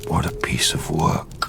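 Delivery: softly whispered irony; bitter whisper. Acted. Creature mocking man made in God's image, bitter whisper. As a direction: let it fall as a quiet sneer.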